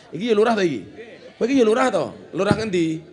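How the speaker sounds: high, loud indignant challenge; mock scolding. Indignant rebuke to the village head who invited him, loud mock scolding. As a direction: fire loud questions as if outraged.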